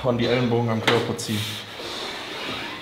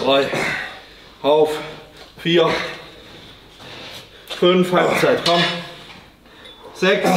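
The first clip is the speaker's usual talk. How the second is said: shouting encouragement; 5 semitones higher, slower than usual. Real, not acted. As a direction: drive him through the last reps. Coach shouting reps at lifter.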